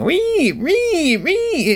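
very high screeching "wee" imitating the Psycho violin stabs; Psycho shower screech. Patient recalling the painful catheter, mimicking Psycho shower screeches. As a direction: squeal it like the Psycho shower scene music. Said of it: "the shower scene from Psycho".

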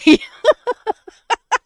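high, loud burst of laughter; loud real cackle that runs on; real laughing fit. Real, not acted. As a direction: crack up helplessly at the memory. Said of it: "I'm still laughing about this".

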